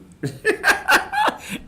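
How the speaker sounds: high breathless laugh; real cackle. Real, not acted. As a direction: burst out laughing. Laughs at sister's outburst.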